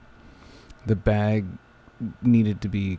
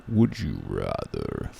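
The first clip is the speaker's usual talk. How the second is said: very low, slow ghostly voice; spooky voice; 8 semitones lower, slower than usual. Acted. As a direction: drop the voice deep and slow, like a ghoul. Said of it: "the spooky voice".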